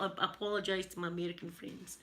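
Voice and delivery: ordinary talk, nothing marked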